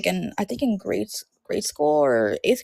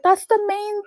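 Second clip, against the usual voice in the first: high-pitched, slowing imitation of a child insisting; frustrated kid; 11 semitones higher, slower than usual. Little cousin insisting on the story's main thing, frustrated. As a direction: mimic a small boy's frustrated insistence, voice way up. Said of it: "all frustrated".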